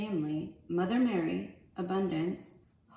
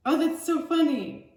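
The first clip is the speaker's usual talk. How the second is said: amused; 7 semitones higher, 8 dB louder than usual. Real, not acted. Amused at herself.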